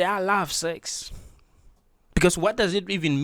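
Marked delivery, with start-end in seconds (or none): 0.0-1.1 bold admission. Shown as a demonstration. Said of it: "I must be able to say".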